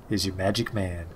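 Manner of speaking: a hint of a deep showman; surprisingly deep. A narrator's reading. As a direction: drop it low and quiet for the pitch. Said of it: "his voice is surprisingly deep".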